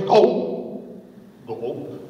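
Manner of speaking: breathy imitated swallowing; hollow swallow sounds; snake gulping. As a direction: gulp like a drinking snake. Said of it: "and the snake goes".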